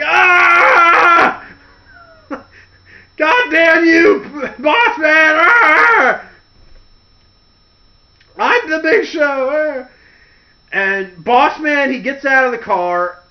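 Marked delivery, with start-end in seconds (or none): 0.0-9.9 high, loud enraged shout; furious roar. Retold or imitated. Big Show cursing the boss, furious shout. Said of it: "Big Show's like".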